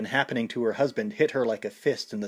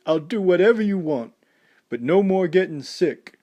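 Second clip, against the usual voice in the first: higher, louder sobbing plea; crying out a promise; 7 semitones higher, 5 dB louder than usual. A narrator's reading. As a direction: beg through tears. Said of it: "Rocky sobbed".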